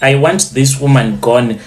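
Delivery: usual speaking voice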